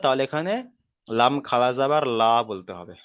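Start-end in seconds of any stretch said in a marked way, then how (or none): 1.1-2.3 final "লা" as an open, light "laa"; thin light L. Shown as a demonstration. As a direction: spell it ending on a thin "লা", "laa". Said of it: "যদি এটা বারিকের ক্ষেত্রে হয়". ("লা" বারিক করে, পাতলা উচ্চারণে)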